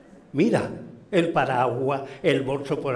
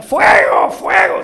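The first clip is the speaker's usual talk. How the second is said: loud, high shouts of alarm; full-voiced, high cry of fire; screaming for help; 12 dB louder than usual. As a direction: shout as if trapped and calling for rescue. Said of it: "empieza a gritar".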